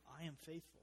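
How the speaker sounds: soft, steady promise; soft and sure; quiet assurance. Retold or imitated. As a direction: hush it like a gentle vow. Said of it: "reminding them".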